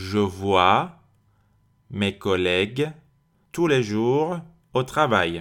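phrase by phrase, pausing after each chunk; repeat-after-me drill. Shown as a demonstration. Teacher modelling an example for learners, in separated chunks. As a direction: break the sentence up for learners to echo. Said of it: "Répétez après moi".

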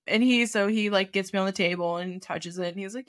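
ordinary talk

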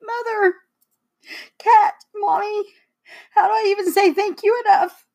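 high, tearful voice; choked-up thanks, pitched high; trying not to cry. Real, not acted. Daughter thanking Mom for Mother's Day, holding back tears. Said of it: "I'm trying not to cry".

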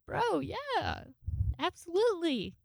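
high, eager yes to the invite; excited yes. Retold or imitated. Agreeing eagerly to host trivia night, excited. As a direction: jump at the offer with a squeal.

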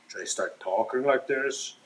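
a put-on voice, lightly changed from his own; modulated voice. Shown as a demonstration. Nervous podcaster trying out a new mic voice, lightly put-on. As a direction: try on a different voice for the mic. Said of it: "should I start modulating my voice".

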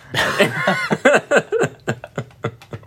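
big high-pitched burst of laughter; loud high laugh. Real, not acted. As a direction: let it all out in a cackle. Laughing after quoting friend.

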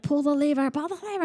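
high-pitched imitation of an urgent cab; cartoon cab. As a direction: play the frantic cartoon cab. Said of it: "Benny's like".

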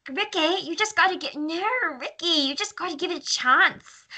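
high, exaggerated parody accent; mocking TV-show accent. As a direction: plead in a hammed-up TV accent. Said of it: "My favorite line to say".

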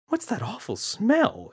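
sniffing the air, repulsed; mother's voice. Acted. Mother smells something.

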